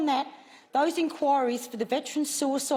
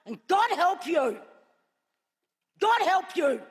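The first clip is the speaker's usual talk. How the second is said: angry. Real, not acted. Senator warns government.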